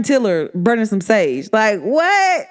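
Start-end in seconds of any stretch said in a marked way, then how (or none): 1.5-2.5 shouted high disbelief into a laugh; shocked outburst. Real, not acted. Reacting to cousin burning sage.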